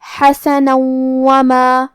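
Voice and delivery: very slowly recite حسنا وما, merging into a long nasal w; level chant of حسنا وما with hummed doubled w; ghunnah with shaddah. Shown as a demonstration. Teacher demonstrating idgham, very slow nasal tajweed recitation. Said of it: "غنہ اور تشدید کے ساتھ".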